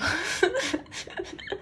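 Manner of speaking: high laugh cutting off the singing. Real, not acted. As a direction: crack up mid-song. Cracks up during the bridge.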